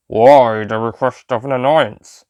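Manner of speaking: very slow, flat robotic drone; robotic Cyberman voice. A narrator's reading. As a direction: speak like a cold machine, word by word. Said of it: "the cyber leader asked".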